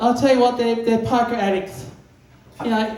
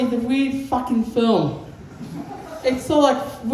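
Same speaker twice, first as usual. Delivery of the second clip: ordinary talk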